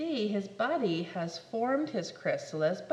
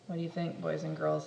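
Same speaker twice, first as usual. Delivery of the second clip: usual speaking voice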